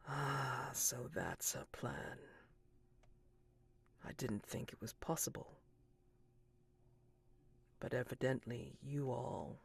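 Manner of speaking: hushed, measured musing with long pauses; man in the sun mask. Acted. Man in the sun mask to the guests, hushed musing. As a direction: speak softly, as if working it out.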